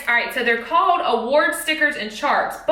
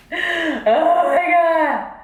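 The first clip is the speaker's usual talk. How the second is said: laughing; 5 semitones higher, 3 dB louder, much slower than usual. Real, not acted. Laughing at blanking mid-sentence.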